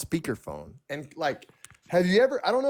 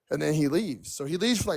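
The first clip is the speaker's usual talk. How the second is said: ordinary talk, nothing marked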